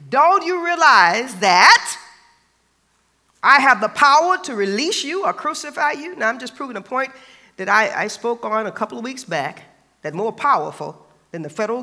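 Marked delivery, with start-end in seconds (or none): 0.1-6.1 Pilate demanding. A narrator's reading. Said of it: "Pilate demanded".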